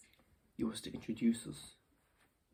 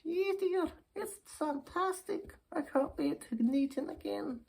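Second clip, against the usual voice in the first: put-on high-pitched, thrilled old dear; smitten old lady. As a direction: gush like an old lady with a crush. Old lady excited about suitor.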